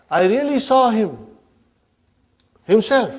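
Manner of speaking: "really saw him" lifted, then "himself" set apart after a pause; emphatic. Shown as a demonstration. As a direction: stress "himself" on its own, after a long pause. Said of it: "emphasizing it".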